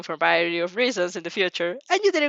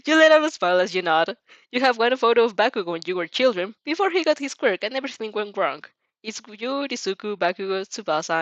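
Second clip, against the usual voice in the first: plain reading voice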